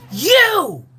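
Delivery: high, loud exclaimed you; shocked recognition. Retold or imitated. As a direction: shout it as it dawns on you. Recognising him in photo.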